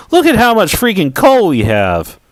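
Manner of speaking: high, excited burst. Real, not acted. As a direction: cheer at the big haul of coal. Sees huge haul of coal.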